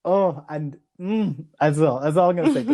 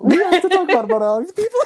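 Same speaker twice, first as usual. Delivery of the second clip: much higher, louder playful insistence; excited teasing; 6 dB louder, faster than usual. Real, not acted. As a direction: tease her into spilling it, laughing. Teasing co-host to share feelings.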